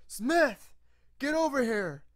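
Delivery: loud, high shout across the workshop; calling out. Acted. Smith shouting for his assistant to bring the prototype, loud. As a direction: bellow for Smith to come.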